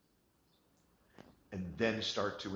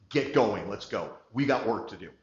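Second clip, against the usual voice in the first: much louder rallying call; energizing shout; 13 dB louder than usual. Shown as a demonstration. Coach showing how to energize a team, loud rallying shout. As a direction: fire them up loudly. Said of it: "Complete change".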